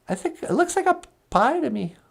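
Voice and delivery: very high, bright greeting; a cheerful high puppet hello; [name]'s puppet voice. Acted. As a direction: pitch way up for the puppet.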